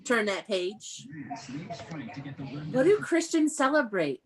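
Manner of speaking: ordinary talk, nothing marked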